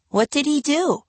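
high little-sister voice; small girl asking; eight-year-old girl. A narrator's reading. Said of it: "for an eight-year-old".

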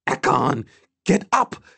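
high, insistent call to rouse someone; desperate rousing cry; urgent plea. A narrator's reading. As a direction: plead with them, pitch climbing. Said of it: "a plea in her eyes".

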